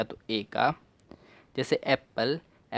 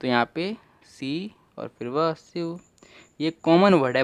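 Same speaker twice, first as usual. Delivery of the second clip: ordinary talk, nothing marked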